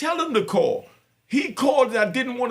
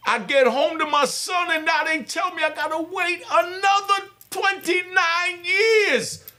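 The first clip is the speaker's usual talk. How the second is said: rising into a higher, anguished shout; emotional outburst; 5 semitones higher than usual. Real, not acted. Father shouting out his pain over his son's sentence, anguished. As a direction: let the anger break through on years. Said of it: "Yeah, I'm emotional".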